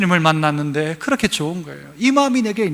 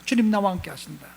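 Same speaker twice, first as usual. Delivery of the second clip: much softer, lower murmur of 주님 나와 함께 하신다; soft confession; 6 semitones lower, 12 dB quieter than usual. Shown as a demonstration. Pastor voicing a quiet inner confession. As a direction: hush it like a quiet prayer. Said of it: "이게 고백되기 시작하는 거예요".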